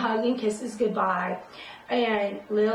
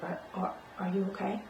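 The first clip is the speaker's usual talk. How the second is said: softer, halting nurse; soft, stammering, horrified nurse; hesitant nurse; 3 semitones lower, 9 dB quieter, faster than usual. Retold or imitated. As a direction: stammer it quietly, shaken. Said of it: "And she's like".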